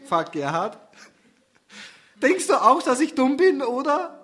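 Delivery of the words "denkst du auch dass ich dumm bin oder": much higher, louder tearful plea; pleading. Acted. Susanne asking Gerhard if she is stupid, high and tearful. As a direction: beg for reassurance. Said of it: "Sagt Susanne".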